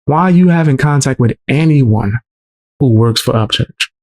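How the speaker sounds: ordinary delivery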